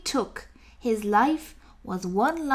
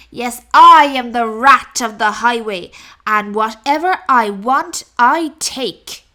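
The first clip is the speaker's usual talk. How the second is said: booming, demanding highway robber rat; greedy rat roaring at the rabbit for cakes; loud bullying rat; 8 dB louder than usual. Acted. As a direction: bellow it like a greedy bandit. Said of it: "who cried out".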